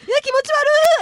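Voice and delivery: loud high squeal of disgust; grossed-out shriek. Real, not acted. Reacting to the tongue trick.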